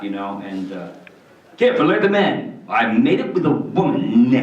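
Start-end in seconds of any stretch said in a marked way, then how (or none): 1.6-4.3 pompous Zapp. Acted. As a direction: puff up and brag.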